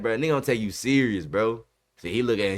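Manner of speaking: normal talking voice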